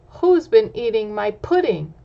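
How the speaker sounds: a hint of a gruff father bear asking; Papa Bear. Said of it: "Papa Bear says".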